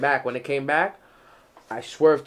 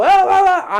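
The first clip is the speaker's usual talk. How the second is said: angry; 11 dB louder than usual. Retold or imitated. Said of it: "He's like".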